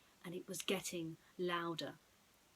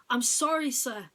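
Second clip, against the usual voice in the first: nervous; 4 semitones higher, 10 dB louder than usual. Acted. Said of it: "now very nervous".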